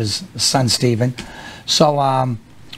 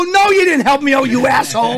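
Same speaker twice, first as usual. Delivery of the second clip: mock outburst; 9 semitones higher than usual. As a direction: fire it back at him. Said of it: "I go".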